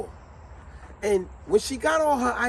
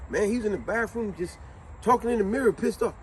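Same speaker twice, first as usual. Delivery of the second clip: ordinary delivery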